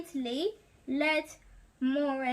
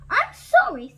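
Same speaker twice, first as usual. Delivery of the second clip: frightened apology; 7 semitones higher, 7 dB louder than usual. Acted. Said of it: "said Maurice".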